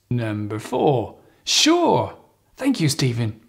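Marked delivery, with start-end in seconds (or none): 1.4-2.2 "Sure" very high, then falling; high pitch intonation. Shown as a demonstration. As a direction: pitch "Sure" way up to sound friendly. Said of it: "please remember, the higher".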